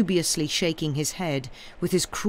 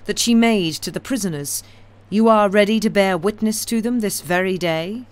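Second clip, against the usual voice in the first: stern, pressing, louder; sternly; 5 semitones higher, 6 dB louder than usual. A narrator's reading. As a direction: demand an answer firmly. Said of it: "said Madame Defarge sternly".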